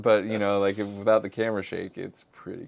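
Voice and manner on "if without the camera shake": ordinary talk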